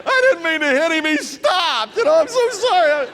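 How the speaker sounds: hammed-up, shouting through laughter; excited. Mock apology in excitement.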